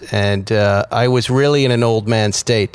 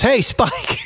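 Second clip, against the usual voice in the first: high friendly greeting; 11 semitones higher than usual. Retold or imitated. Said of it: "and he goes".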